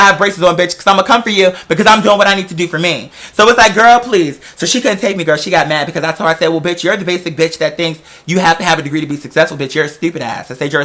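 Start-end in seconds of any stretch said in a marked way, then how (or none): none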